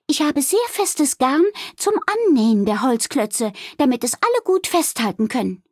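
high-pitched, keen innkeeper voice; busy innkeeper Wilma. Acted. Wilma planning the sewing with Balduin, keen. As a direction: explain practical details in a high voice.

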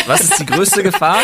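can barely finish the question for laughing; laughing through words. Real, not acted. Cracks up mid-rant. (lachend, höher und lauter)

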